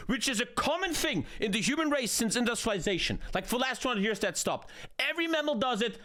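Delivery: high-pitched incredulous rant, climbing; escalating comedy rant. Real, not acted. Streamer ranting at chat's accusation.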